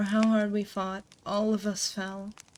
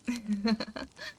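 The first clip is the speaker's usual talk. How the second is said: light amused laugh; a small knowing chuckle; quiet laugh; soft chuckle; 4 dB quieter than usual. Acted. Amused by listener's remark.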